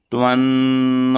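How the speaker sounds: त्वन्नो with the nasal held long; drawn-out nasal. Shown as a demonstration. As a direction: stretch the न्न in त्वन्नो. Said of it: "खंडाकार है तो खींचेंगे". (त्वन्नो में न्न को खींचकर बोलें)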